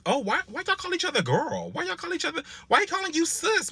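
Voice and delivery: high, nosy, imitated sniping; mock the gossiping behind his back; catty gossip. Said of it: "when I left, it's".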